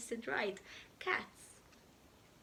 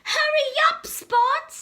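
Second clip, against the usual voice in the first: loud, high call; 14 dB louder than usual. Acted. Dad calls out loudly to Spot. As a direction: call Spot from across the farm.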